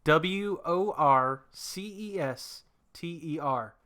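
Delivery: slow letter-by-letter spelling. Shown as a demonstration. Co-host spelling a Massachusetts town name, spelling out. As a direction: spell W O R C E S T E R out slowly, one letter at a time. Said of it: "that's spelled".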